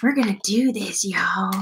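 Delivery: a light impression of the little guy from Gravity Falls; little cartoon guy. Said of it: "He talks like this".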